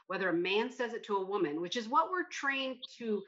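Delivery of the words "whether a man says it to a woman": ordinary talk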